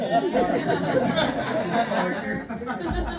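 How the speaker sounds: loud laughing burst; big laugh. Real, not acted. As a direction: laugh hard at the confession. Laughs at own admission.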